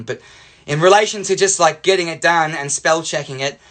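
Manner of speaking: ordinary delivery, nothing marked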